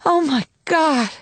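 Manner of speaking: high, slow outburst of relief; tears of wonder breaking in; awestruck cry. A narrator's reading. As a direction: sister overwhelmed as the baby arrives. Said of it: "I started to cry".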